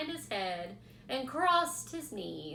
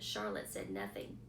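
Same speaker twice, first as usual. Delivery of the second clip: ordinary delivery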